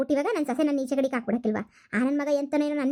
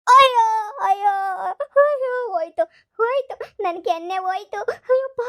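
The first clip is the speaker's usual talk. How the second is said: high, loud comic crying over a stinging cheek; hammed-up wailing; 7 semitones higher, 5 dB louder than usual. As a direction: wail like the cheek is gone. Grandma wailing after second slap.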